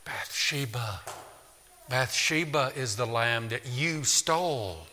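a hushed inner accusation; conscience whispering. A narrator's reading. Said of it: "his conscience whispers".

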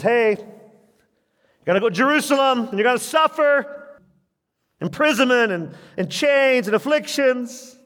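high, lightly voiced divine messenger; Holy Spirit voice. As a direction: announce the warning in a high voice. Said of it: "Holy Spirit says".

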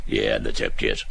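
Frank in a low, dull, clueless voice. Retold or imitated. A clueless partner answering in a low, soft, dull voice. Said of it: "Bob and Frank".

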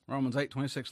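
usual speaking voice

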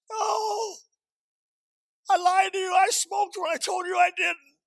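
high, loud mock wail; imitated weeping. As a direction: wail out the confession, imitating a distraught wife.

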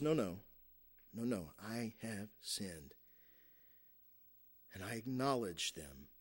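hushed confession. Acted. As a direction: drop right down and confess it quietly. Preacher voices David's humble confession.

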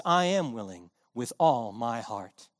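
low, soft Goodwill welcoming the pilgrim; kindly gatekeeper. Acted. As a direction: open the door with a gentle yes.

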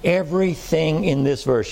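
ordinary talk, nothing marked

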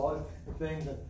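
ordinary talk

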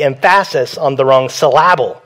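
"emphasis" and "syllable" each stressed on the second syllable; jokingly misplaced stress. Shown as a demonstration. Pastor jokes about word accents, "emphasis" and "syllable" stressed mid-word. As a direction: lean on the middle of "emphasis" and "syllable", wrongly. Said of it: "moving the accent into the wrong part of the word".

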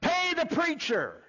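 hammed-up pulpit punchline; imitating the preacher. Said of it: "That's exactly how he said it".

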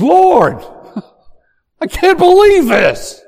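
high, loud cry of disbelief to the Lord; loud, high frustrated outburst at God; exasperated prayer. Acted. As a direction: blurt it out with a half laugh, fed up. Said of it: "type of prayer".

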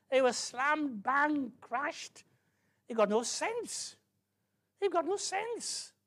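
high-pitched, loud, exasperated preacher; astonished pulpit rant; incredulous preaching. Acted. Preaches in mock disbelief.

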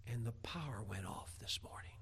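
hushed, breathless whisper; whispered confession to husband about dinner. Retold or imitated. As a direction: let the bad news slip out in a whisper. Wife's bad news, hushed.

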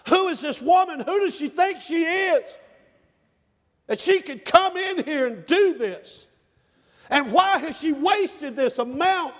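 mock the woman like a sneering critic; indignant critics. Critics scolding the woman, acted.

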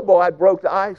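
ordinary talk

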